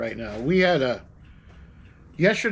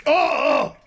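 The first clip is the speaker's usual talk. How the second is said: surprised; 12 semitones higher than usual. Real, not acted. Reaction to drink.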